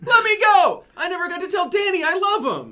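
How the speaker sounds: desperate shout. Acted. Kidnapped character cries out.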